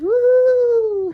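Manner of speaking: high, loud "Woo!" for her; voicing the daughter's happy whoop; excited cheer. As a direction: cheer it out like a thrilled kid. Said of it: "She says".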